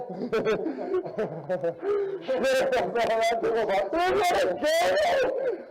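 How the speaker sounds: big laugh. Real, not acted. Laughing during firefight.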